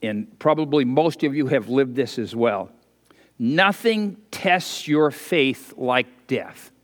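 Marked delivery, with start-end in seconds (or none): 3.4-6.7 slow and weighty. Shown as a demonstration. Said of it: "I'll say that again".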